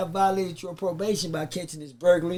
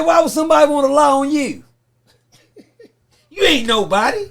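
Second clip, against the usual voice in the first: high, loud scornful challenge; mock the prosecutor's put-down, breaking into laughs; going hard; 8 semitones higher, 10 dB louder than usual. Prosecutor scorning the witness in court, loud and high, retold with laughter. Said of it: "He went hard on me".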